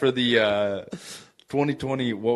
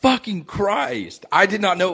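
shocked; 10 semitones higher than usual. Real, not acted. Shocked at divorce date.

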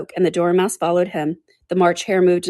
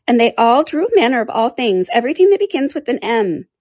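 higher little creature voice; lightly voiced animal storyteller; sleepy dormouse; 6 semitones higher, 5 dB louder than usual. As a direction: carry on the tale in a small, high voice. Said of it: "He went on yawning".

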